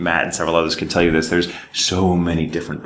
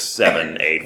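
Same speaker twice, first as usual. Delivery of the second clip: ordinary delivery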